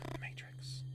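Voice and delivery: hushed unvoiced aside; whisper. Real, not acted. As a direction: whisper the title to yourself. Aside naming the movie quote.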